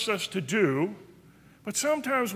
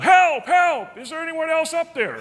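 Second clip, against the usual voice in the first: shouted higher and louder for help; desperate yell; 8 semitones higher, 6 dB louder than usual. Retold or imitated. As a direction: yell for anyone else up there. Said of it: "Jack yelled".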